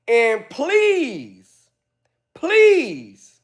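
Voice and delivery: high mock begging; mock pleading. Real, not acted. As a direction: beg with exaggeration. Pleading sarcastically.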